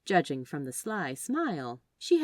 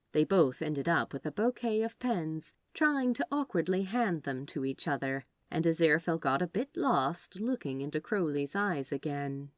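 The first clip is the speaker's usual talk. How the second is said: usual speaking voice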